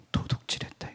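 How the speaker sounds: voiceless whisper of God's judgment; a hushed solemn accusation; whispered verdict. Acted. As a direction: whisper the final word gravely. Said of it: "하나님 한 말씀 하셨어요".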